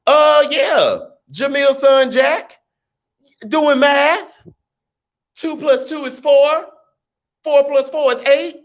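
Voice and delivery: high excited shout-out; hype shout-out. Real, not acted. Shouting out a viewer's child.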